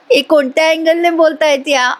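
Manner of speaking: amused disbelieving laugh; chuckling through the words. Real, not acted. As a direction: laugh at the absurd line. Laughing at the show's logic.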